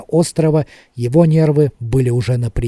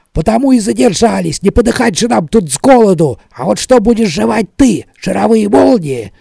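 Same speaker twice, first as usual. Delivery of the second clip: raised, high-pitched angry outburst; snappish; 7 semitones higher, 5 dB louder than usual. A narrator's reading. Smed berating Tally about going hungry, snappish. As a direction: throw the complaint back loudly, exasperated. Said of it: "огрызнулся Смет".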